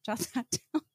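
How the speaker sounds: ordinary talk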